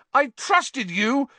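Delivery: high, loud, slow, wavering shout; furious shout. A narrator's reading. Harry shouts at Lupin in fury, high and wavering. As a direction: shout with fury barely held. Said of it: "his voice wavering out of control".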